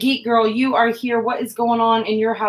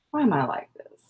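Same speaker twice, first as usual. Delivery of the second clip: normal talking voice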